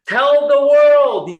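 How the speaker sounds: screamed slowly with all his might, high and loud; a drawn-out cry while running for his life; desperate scream. Retold or imitated. Camp escape leader shouting to the world as they flee, desperate scream. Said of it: "they screamed out and he said".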